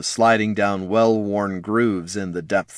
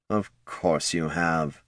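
dry dismissive; 3 semitones lower, 6 dB quieter, slower than usual. Acted. Lich dismissive, looking away.

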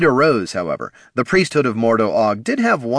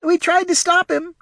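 small pup voice; 3 dB louder than usual. A narrator's reading. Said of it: "Dogo added".

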